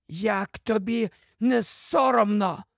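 high, husky reproach; wheezy. A narrator's reading. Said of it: "просипів хтось третій". (сипло, високо, з докором)

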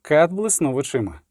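ordinary delivery, nothing marked

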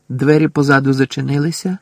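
ordinary delivery

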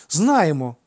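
answering in chorus. A narrator's reading. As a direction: call it out like eager twins. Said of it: "хором відповіли близнюки". (хором, голосно й дружно)

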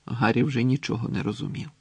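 plain reading voice

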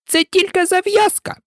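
very high, indignant mouse squeal; piercing angry cry. A narrator's reading. Mouse corrects Alice about the story, piercing and angry. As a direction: insist crossly at the top of the pitch. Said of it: "закричала миша пронизливо і дуже сердито".

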